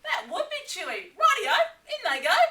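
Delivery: Dingo, thrilled, laughing; gleeful Dingo. Acted. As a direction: rush it out, delighted. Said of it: "Dingo smiled".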